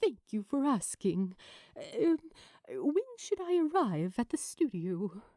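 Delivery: brightly strained, bright on top and hesitant beneath; forced cheerfulness. Acted. Az on the phone to a talk show host, forced cheer. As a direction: sound chipper while hiding fury. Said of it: "Az tried to sound cheerful".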